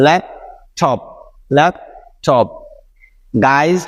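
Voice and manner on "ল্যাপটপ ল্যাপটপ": each "laptop" split by a pause between lap and টপ; syllable by syllable. Shown as a demonstration. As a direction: say lap, stop, then টপ, both times. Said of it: "যা মিলে হচ্ছে থপ". (প্রতিবার "laptop" দুই ভাগে থেমে থেমে)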